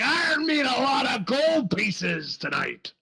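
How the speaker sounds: slow, boisterous pirate boast; pirate accent. Acted. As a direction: crow about the gold like a happy drunk pirate. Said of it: "He's like".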